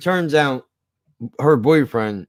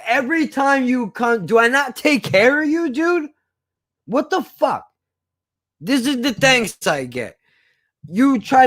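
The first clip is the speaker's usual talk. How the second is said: outraged confrontation; 9 semitones higher than usual. Retold or imitated. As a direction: voice climbing much higher with outrage. Confronting girl who cheated him.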